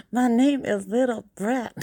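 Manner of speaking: high, broad Chicago accent. Shown as a demonstration. Playing someone from Chicago, Chicago accent. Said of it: "I am from Chicago".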